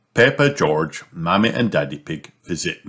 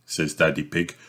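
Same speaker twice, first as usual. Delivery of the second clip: plain reading voice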